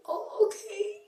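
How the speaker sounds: high, very soft hurt voice; small high voice close to tears; imitating a tearful acceptance; tearful retold okay. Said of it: "I was crying".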